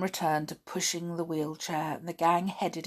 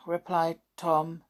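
usual speaking voice

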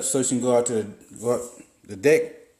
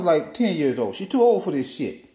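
ordinary talk

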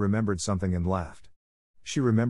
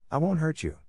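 gentle, higher coaxing to a frightened pet; 8 semitones higher than usual. A narrator's reading. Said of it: "speaking in a coaxing voice".

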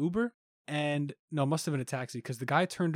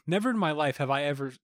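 usual speaking voice

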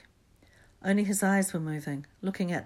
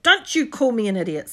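loud, higher defiant retort; indignant shout; 5 semitones higher, 12 dB louder than usual. A narrator's reading. Neville standing up to Ron, loud and indignant. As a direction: fire back, full of indignation.

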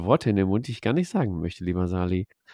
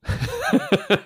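high laugh. Real, not acted. Laughing at missing rum.

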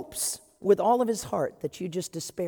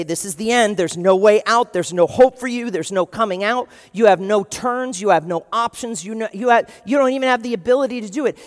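loud, menacing, hammering taunts; bully them into despair; taunting enemy; 2 semitones higher, 9 dB louder than usual. Acted.

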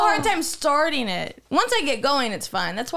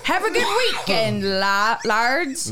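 giggly, badly faked Irish accent; amateur Irish with an R slipped into 'lards'; attempted Irish accent; 3 semitones lower, slower than usual. Shown as a demonstration. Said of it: "Come on, one more time".

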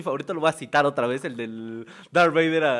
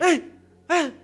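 high mock yelps; imitating sister's yelps; 11 semitones higher than usual. Brother imitating sister yelling in her sleep, high mock yelps. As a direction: yelp it like the sister in a nightmare. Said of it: "escuché a mi hermana gritar".

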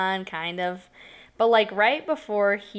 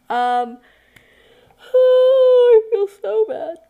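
sighing; 12 dB louder, much slower than usual. Real, not acted. Sighing over her past behavior.

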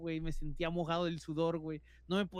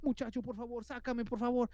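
higher, stressed begging; desperate plea; 5 semitones higher than usual. Retold or imitated. Trapped man begging the boy to free him, desperately. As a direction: plead insistently, getting frantic. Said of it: "Muy desesperado".

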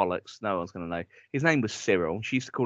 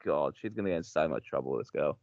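dismayed aside; 9 semitones lower, 6 dB quieter than usual. Retold or imitated. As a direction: mutter low in quiet dismay. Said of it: "I'm like".

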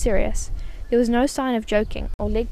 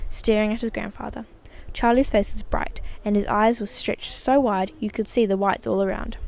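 ordinary delivery, nothing marked

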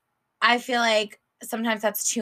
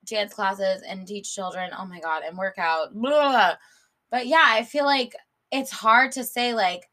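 ordinary delivery

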